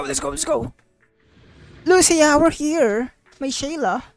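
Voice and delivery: ordinary talk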